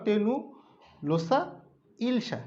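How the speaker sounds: তেনু and লোসা said apart, with a pause between them; split into syllables. Shown as a demonstration. Teacher breaking the hilsa name apart for students, with pauses. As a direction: part by part, with a pause. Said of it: "একসাথে বলতে পারো".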